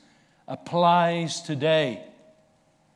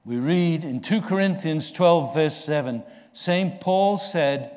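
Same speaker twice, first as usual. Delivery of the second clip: normal talking voice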